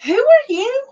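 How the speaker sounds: very high, loud imitated amazement; astonished question.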